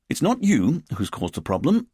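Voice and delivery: stiffly polite, pointed correction. Acted. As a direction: keep it formal and starchy. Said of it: "Richard said stiffly".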